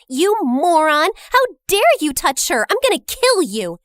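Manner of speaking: high, loud rage; furious yell. Acted. Jenny yells at the guy who grabbed the narrator, furious. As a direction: tear into him.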